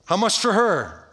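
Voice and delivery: high, forceful mock haggling; acted buyer's call. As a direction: call it out like a bidder at a sale. Voicing a buyer of trafficked girls.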